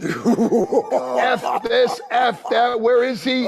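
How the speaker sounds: imitating an angry wrestler storming in; retold angry outburst; fuming rant. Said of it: "he's fuming".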